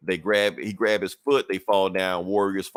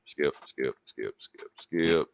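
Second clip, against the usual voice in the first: quiet repeated skip; 7 semitones lower, 6 dB quieter, much slower than usual. Shown as a demonstration. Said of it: "skipping ahead in recap".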